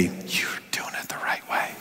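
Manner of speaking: soft, breath-only whisper; whispered validation. Acted. Some other voice validating the boaster, whispered. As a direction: whisper it like secret approval.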